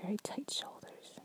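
soft ASMR whisper. Acted. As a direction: breathe it to your partner.

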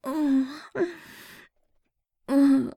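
ordinary delivery

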